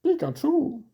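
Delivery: very high squeaky creature call; Pikachu cry. Pretend. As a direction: squeal the name like the Pokémon. Voicing Pikachu's cry during battle.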